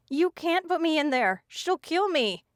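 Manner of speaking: high, terrified pleading; frightened scream. A narrator's reading. Captive pleads in terror not to be jailed. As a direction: beg in panic not to be locked up. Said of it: "I screamed".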